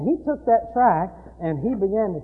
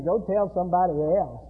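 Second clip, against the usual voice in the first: normal talking voice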